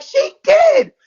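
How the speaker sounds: high-pitched, loud comeback; retold squealed protest; emphatic insistence.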